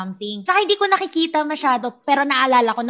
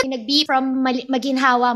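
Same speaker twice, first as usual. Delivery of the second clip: usual speaking voice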